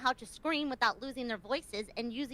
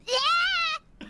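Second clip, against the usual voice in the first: loud, high-pitched "yeah"; excited cheer; 8 dB louder than usual. Real, not acted. Co-host cheers the movie pick, excited shout. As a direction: cheer at the top of your range.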